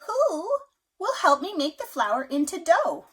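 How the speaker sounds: high, loud hen voice asking around. A narrator's reading. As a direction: call out to the farmyard for help. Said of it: "she asked".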